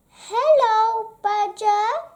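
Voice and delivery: high, cheerful singsong greeting; cheery greeting. A narrator's reading. Squirrel greeting Badger at the door, cheerily. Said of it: "he said cheerily".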